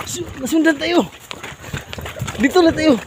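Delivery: high, loud alarmed calls. Real, not acted. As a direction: warn them, alarmed. Fleeing pursuers.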